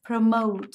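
"Promote" stressed on MOTE; stress on second syllable. Shown as a demonstration. Teacher models word stress for class, "Promote" stressed on second syllable. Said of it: "âm tiết số hai nhá".

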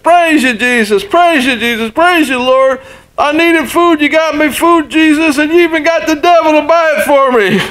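put-on very high, squealing old lady voice of triumph; shrill with delight, laughing at the end; triumphant old lady. Old lady's triumphant punchline.

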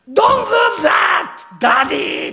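high, loud, drawn-out yell at his father; son's furious shout. Retold or imitated. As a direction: shout it at Daddy like an angry grown son. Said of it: "怒鳴るわけ".

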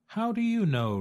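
high-pitched bird questioning; turkey's question. A narrator's reading. Turkey questions the duck, high and squeaky.

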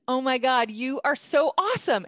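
high, loud mock adoration; over-the-top gushing. Disarming a Twitter opponent with praise, over-the-top gushing. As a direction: gush it emphatically, rising on the praise.

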